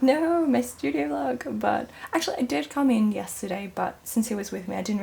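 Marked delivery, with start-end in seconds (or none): 0.0-1.6 mock dismay. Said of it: "and I was like".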